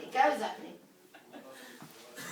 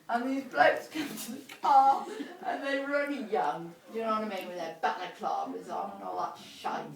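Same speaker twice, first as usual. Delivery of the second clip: ordinary delivery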